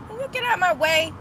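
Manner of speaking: sudden loud, high snap at a driver; yelling at traffic. Real, not acted. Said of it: "sorry, that was not road rage".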